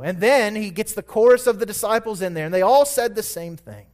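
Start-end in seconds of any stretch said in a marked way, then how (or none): none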